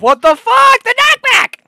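high, loud cry of disbelief; outraged yell. Real, not acted. Player knocked back in game, outraged yell. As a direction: yell in exasperation.

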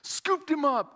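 usual speaking voice

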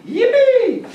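very high, loud cry of joy; happy whoop. Shown as a demonstration. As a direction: let out a gleeful squeal. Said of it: "we're happy".

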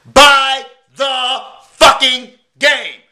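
each word punched slow and loud; a pitchman's catchphrase, every word hit hard; slow hammered slogan. Acted. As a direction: drill "Buy the fucking game" in word by word, slow and loud.

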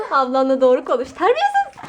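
mock scolding.

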